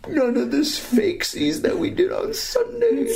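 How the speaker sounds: very high, squeaky mock assurance; mock a feeble sales promise. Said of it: "very feebly, by saying".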